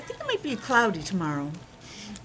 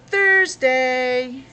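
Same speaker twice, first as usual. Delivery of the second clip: announcing Thursday; 3 semitones higher, 8 dB louder than usual. Acted. Revealing tomorrow's day.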